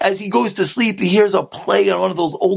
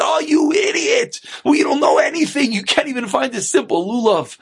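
acted angry father, pitched up then easing; screaming out; 7 semitones higher than usual. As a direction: berate your son in exasperation. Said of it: "And the father screams out".

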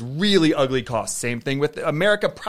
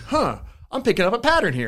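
lightly voiced kid catching on, a touch higher; imitating the kids; 5 semitones higher than usual. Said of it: "the kids are like".

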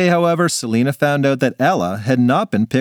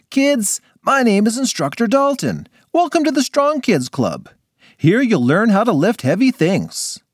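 lightly voiced hearty coach; booming friendly trainer; gym instructor; 10 semitones higher than usual.

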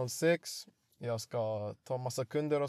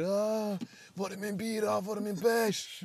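high-pitched mock shouting; imitated crowd yelling; 7 semitones higher than usual. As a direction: mimic impatient fans calling out for beer. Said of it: "de skriker". (härmade skrik, gällt och högt)

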